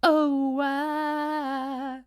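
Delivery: playful high mock croon; playful sing-song. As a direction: toss it off like jazz hands. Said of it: "I feel like jazz handing".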